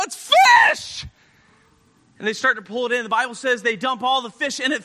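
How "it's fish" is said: astonished yell of discovery; excited cry. Acted. Fishermen hauling up the full net, excited cry. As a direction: burst out with the last word. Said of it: "and they go".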